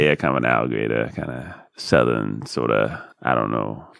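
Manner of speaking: put-on swampy alligator-country accent, kept low; alligator Southern accent. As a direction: vague mock Southern accent. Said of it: "Alligator accent".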